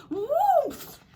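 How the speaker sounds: high, loud "woof" whoosh; sudden high-pitched whoosh noise; falling whoosh. Acted. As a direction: voice the whoosh of a fall. Sound of the falling tree.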